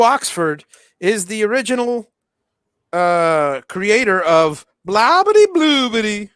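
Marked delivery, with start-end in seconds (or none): 4.9-6.3 put-on Gomer Pyle accent. Crediting Drew as creator of the catchphrase, Gomer Pyle accent. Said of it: "with that Gomer Pyle accent of his".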